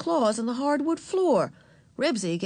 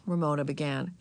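plain reading voice

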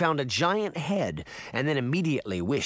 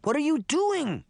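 put-on high, alarmed little girl's voice; a young sister crying out in alarm. A narrator's reading. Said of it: "Daphne cried".

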